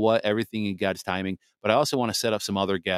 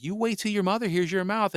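scolding parent; 11 semitones higher than usual. Retold or imitated. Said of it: "I was like".

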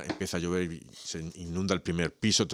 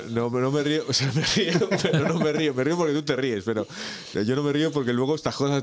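laughing while talking; 7 semitones higher, 4 dB louder than usual. Real, not acted. Laughing after car stolen at garage.

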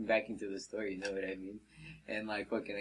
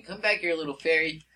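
higher, louder pursuer imitation; mock taunting call; 7 semitones higher, 5 dB louder than usual. Fisherman chasing the worker up the rocks, mock taunting call. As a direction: call after him in a taunt.

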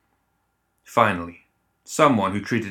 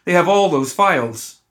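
much higher and louder, panicky; frightened, high-pitched blurt; 9 semitones higher, 5 dB louder than usual. A narrator's reading. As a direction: blurt it out in fear. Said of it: "the administrator stammered".

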